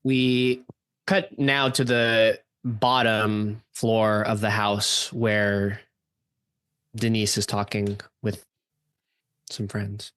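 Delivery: normal talking voice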